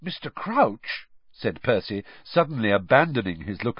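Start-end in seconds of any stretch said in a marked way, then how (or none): 0.0-1.1 high, drawn-out awed question; slow, eager reverence; writhing with excitement. A narrator's reading. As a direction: breathe the name like a devoted admirer. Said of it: "positively writhing with excitement".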